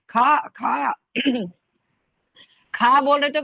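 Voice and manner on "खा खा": खा, खा with a heavy, aspirated ख from the chest. Shown as a demonstration. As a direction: push खा out from the chest. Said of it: "सीने की तरफ से भारी आवाज़". (सीने से भारी खा)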